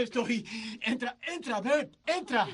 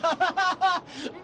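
giddy laugh; 6 semitones higher than usual. Acted. Joyful laugh after line.

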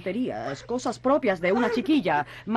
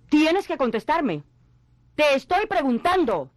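higher-pitched, insistent demand; angry insistence; 7 semitones higher than usual. Acted. Mother presses her silent daughter, angrily insistent. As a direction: demand an answer with rising anger.

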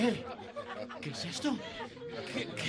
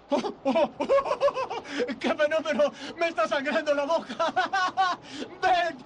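high, laughing delight; giddy laughter over a bleeding lip; ecstatic laughing; 4 semitones higher than usual. Acted. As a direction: laugh through it, thrilled by everything.

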